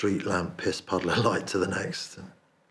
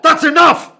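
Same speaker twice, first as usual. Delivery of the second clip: high, loud desperate scream; frantic screamed plea; panicked scream; 19 dB louder than usual. Retold or imitated. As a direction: scream it at full force. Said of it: "And I'm going".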